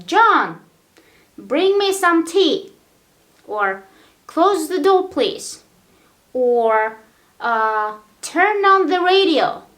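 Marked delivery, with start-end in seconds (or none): 0.1-2.7 louder and higher, calling out; bossy order to brother. Pretend. Telling a brother to bring tea, calling loudly. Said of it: "you have a brother". 4.3-5.6 calling out. Pretend. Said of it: "you say". 8.2-9.6 loud, higher call; calling command. Pretend. Ordering a little sister to turn on the radio, calling out. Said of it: "you say".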